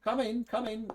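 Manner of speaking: lightly voiced woman inviting in; woman calling. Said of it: "a voice calls and says".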